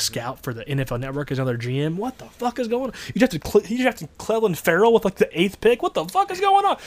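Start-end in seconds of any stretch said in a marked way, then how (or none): 2.0-6.8 high-pitched, incredulous rant; rising disbelief, pitched way up; amazed outburst. Real, not acted. Ranting in amazement at team's moves.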